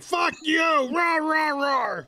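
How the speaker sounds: very high mock dog yell turning into barks; angry barking dogs. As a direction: voice dogs yapping back in outrage. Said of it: "And they're like".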